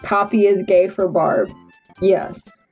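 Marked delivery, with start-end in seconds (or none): none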